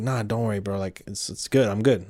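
soft reassurance. Retold or imitated. Friend reassuring while high.

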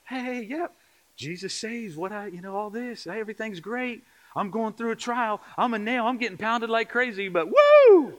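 high fake-chipper front building to a loud whoop; mock upbeat church greeter hiding trouble; pretend cheerfulness. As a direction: put on everything's-great pep, ending in a big high woo. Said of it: "pretend like everything's okay".

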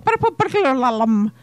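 Scottish take, rolling the r's; quick Scottish "Purple burglar alarm"; Scottish accent. Shown as a demonstration. Another go at the twister in a Scottish accent. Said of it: "Try and say it with a Scottish accent".

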